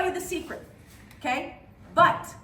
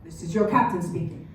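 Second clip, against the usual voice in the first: captain on the intercom; 9 semitones lower than usual. Acted. Pilot waking the passengers over the intercom, low captain voice. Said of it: "I hear the intercom".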